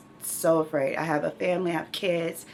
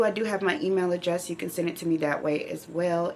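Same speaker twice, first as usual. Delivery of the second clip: ordinary talk, nothing marked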